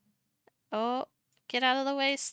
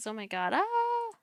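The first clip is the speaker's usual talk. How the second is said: mock-excited gasp, a bit higher; 9 semitones higher than usual. Shown as a demonstration. Showing the excited preset, mock-excited. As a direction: play up the excitement for the model. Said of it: "I like the excited one".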